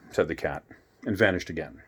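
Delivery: usual speaking voice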